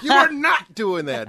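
high, loud, slow mock outrage; imitating triggered Aaron. Mocking teammate Aaron's reaction to fake accents, high and loud. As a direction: ham up a friend's indignant protest. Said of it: "That gets Aaron triggered every time".

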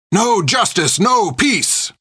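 high shouted chant; crowd cry. A narrator's reading. The crowd crying at the soldiers, shouted chant. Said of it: "they cried".

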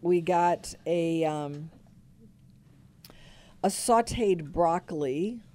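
ordinary talk

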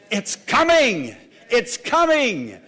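slow, ringing proclamation; rousing pulpit assurance; fervent promise. Real, not acted. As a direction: stretch and punch COMING each time. Preacher promises joy is coming.

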